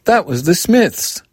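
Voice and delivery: put-on excited child. A narrator's reading. Girl insisting to her mother, excited. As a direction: call it out, bursting with excitement.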